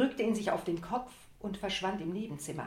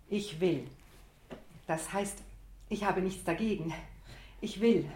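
usual speaking voice

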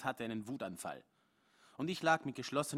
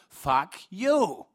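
loud, high roar; bellowing; 7 semitones higher, 14 dB louder than usual. Acted. Man roars through the letterbox at Joan, loud. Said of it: "und brülle".

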